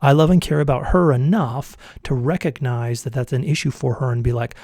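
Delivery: usual speaking voice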